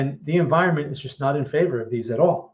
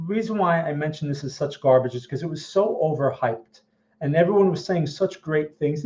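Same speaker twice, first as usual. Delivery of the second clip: usual speaking voice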